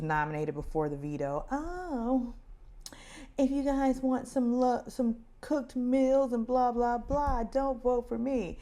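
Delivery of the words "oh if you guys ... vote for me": high, sing-song mocking voice; mock imitation of houseguest. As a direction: parody her sweet offer in a high voice. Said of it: "she was just like".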